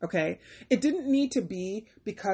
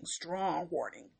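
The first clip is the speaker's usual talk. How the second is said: strong warning muttered much softer as a dry aside; deadpan echo; 3 semitones lower, 9 dB quieter, slower than usual. Acted. Reviewer repeating the phrase in a soft, dry aside. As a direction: drop it under your breath.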